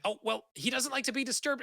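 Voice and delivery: high, fretful little servant voice; nervous servant. Acted. GM as Thub, warning visitors off disturbing his master, in a high fretful voice. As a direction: squeak it out like a worried butler.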